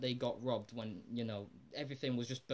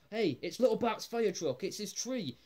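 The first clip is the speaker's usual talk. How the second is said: excited discovery; 7 semitones higher, 3 dB louder than usual. Retold or imitated. Man finds buried fire truck.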